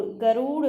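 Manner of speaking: गरुड़ said slowly, each sound clear; clear slow garud. Shown as a demonstration. Teacher to students, slow careful गरुड़. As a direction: draw out गरुड़ so students hear it. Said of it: "उच्चारण करिए".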